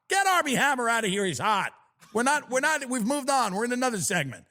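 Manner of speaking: ordinary talk, nothing marked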